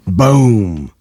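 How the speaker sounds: loud spoken boom; boom sound effect. A narrator's reading. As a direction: punch out a boom. Explosion in story.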